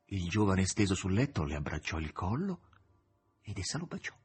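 plain reading voice

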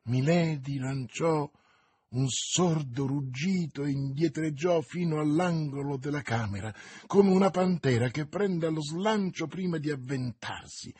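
ordinary delivery

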